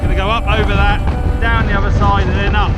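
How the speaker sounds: shouting over wind. Real, not acted. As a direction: yell over the gusts on a hilltop. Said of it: "If you can hear me over the wind, it's a bit blowy".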